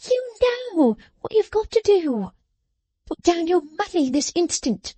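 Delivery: high, sharp scolding voice; scolding little woman. A narrator's reading. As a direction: scold him like a child. Jenny demanding money.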